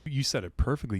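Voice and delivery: ordinary talk, nothing marked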